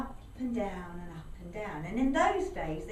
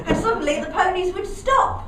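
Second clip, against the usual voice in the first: usual speaking voice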